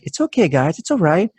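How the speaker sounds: high, sing-song voice to soothe the dogs; talking to scared dogs, high soothing puppy voice. Retold or imitated. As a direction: coo at the dogs like puppies. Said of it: "in puppy voice was like".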